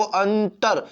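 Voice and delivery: "अंतर" drawn out syllable by syllable, an-tar; slow demonstration. Shown as a demonstration. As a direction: stretch the first syllable of "अंतर". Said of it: "अपने आप के बोलो".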